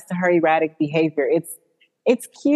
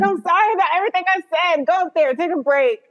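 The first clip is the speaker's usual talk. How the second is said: high, fast, overeager; imitating Misty's rushed apology, high and fast; gushing apology; 9 semitones higher, faster than usual. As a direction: rush the fake apology. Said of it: "She's like".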